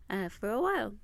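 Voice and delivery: normal talking voice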